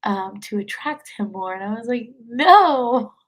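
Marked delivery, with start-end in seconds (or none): 2.3-3.1 loud, drawn-out retold no; emphatic refusal. Woman retelling how she refused him, emphatic. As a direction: push back hard, as in a retelling. Quoting her firm refusal.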